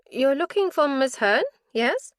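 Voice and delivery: a lightly voiced hospital nurse, eager to help; nurse's voice. Nurse at the station greets the visiting detective, lightly voiced.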